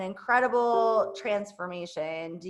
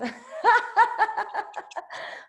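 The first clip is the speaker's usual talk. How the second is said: big high laugh; 12 semitones higher than usual. Real, not acted. Laughing at her own hype.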